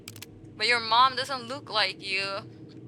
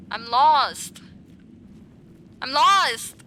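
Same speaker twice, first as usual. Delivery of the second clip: frustrated cry; 3 semitones higher, 7 dB louder, slower than usual. Real, not acted. Streamer lost in the game, crying out. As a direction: call out that you're lost.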